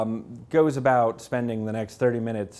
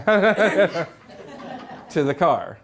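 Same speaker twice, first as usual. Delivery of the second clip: laughing; 6 semitones higher, 4 dB louder, much slower than usual. Real, not acted. Storming off, laughing.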